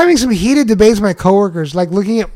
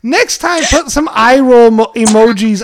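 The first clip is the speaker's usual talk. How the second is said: high-pitched, flustered pleading; mock defensive protest; 6 semitones higher than usual. Husband blaming wife's texting, mock defensive protest. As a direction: plead your case, pitch way up.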